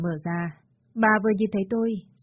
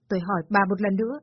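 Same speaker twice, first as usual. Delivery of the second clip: ordinary delivery, nothing marked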